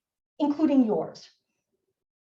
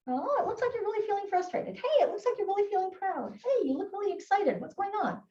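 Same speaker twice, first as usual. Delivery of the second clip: higher-pitched, gentle noticing of a feeling; softly curious, higher voice for a child; upbeat concern; 6 semitones higher, 3 dB quieter than usual. Shown as a demonstration. Adult naming a child's feelings, upbeat and caring.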